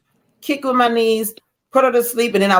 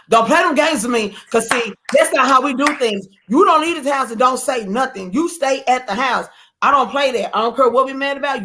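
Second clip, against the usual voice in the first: higher-pitched, emphatic telling-off; scolding spouse; 5 semitones higher than usual. Retold or imitated. Spouse lays down rules after a walkout, scolding. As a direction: lay down the house rules, voice raised in pitch.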